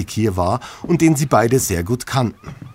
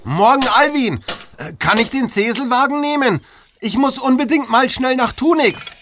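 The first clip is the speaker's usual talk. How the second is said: eager character voice; 14 semitones higher than usual. Acted. Murkas voiced in story.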